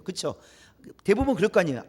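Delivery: normal talking voice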